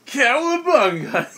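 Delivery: high, drawn-out battle cry. Acted. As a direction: holler it out slowly as you leap. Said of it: "and he's like".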